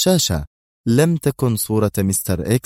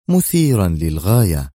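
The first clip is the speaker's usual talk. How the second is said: usual speaking voice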